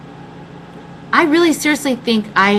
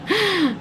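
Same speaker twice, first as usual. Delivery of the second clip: short high laugh; 11 semitones higher, 5 dB quieter than usual. Real, not acted. Laughs at own punchline.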